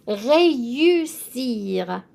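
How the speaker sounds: "Réussir" drawn out very slowly, syllable by syllable; teacher modelling réussir slowly; slow French model. Shown as a demonstration. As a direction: stretch "Réussir" out slowly for students. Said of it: "I am pronouncing the E with the accent as an A".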